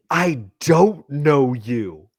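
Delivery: very slow, deliberate denial; measured, baffled insistence; emphatic retold denial. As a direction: stretch each word as if explaining the obvious. Denial repeated after interruption.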